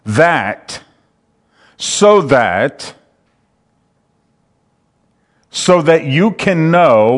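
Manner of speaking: "that" set apart by long pauses; emphatic, deliberate stress. Shown as a demonstration. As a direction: hold back, then hit "so that". Stressing the word usually missed.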